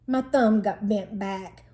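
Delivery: ordinary delivery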